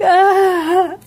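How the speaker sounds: high, dismayed ah; imitating the agent's dismay; mock dismayed ah. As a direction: groan at the caller. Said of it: "she was like".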